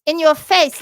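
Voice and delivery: loud high mocking taunt; loud playground jeer. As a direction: gloat at her loudly. Donkey voiced taunting her.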